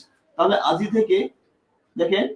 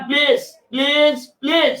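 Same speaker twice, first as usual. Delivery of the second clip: slow, higher, drawn-out pleading; pleading please; 5 semitones higher, slower than usual. Acted. Teacher pleading with the class to pay attention, drawn out. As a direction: beg them to look, stretching each word. Teacher begging students to look.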